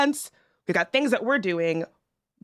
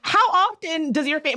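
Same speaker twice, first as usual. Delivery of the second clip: ordinary talk, nothing marked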